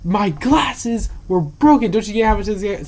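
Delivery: aggrieved mock shouting; retold yelling. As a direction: imitate an irate patron, leaning on each word. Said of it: "she's yelling at me".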